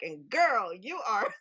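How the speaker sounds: playful mock Australian heartthrob; half-hearted Australian accent; Chris Hemsworth impression. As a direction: imitate a hunky Australian flattering a girl. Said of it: "He's just like".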